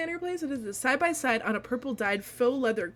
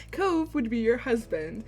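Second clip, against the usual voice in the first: normal talking voice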